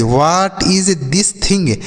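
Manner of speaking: very slow, each word clear; slow model question. Shown as a demonstration. Teacher slowly models asking a friend "What is this thing?". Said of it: "तो आप क्या कहेंगे".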